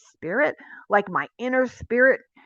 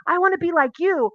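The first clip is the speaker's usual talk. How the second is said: eager follower; 7 semitones higher than usual. Retold or imitated. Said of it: "you're like".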